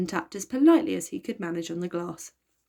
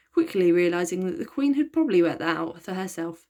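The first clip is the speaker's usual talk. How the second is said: usual speaking voice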